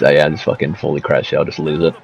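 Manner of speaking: usual speaking voice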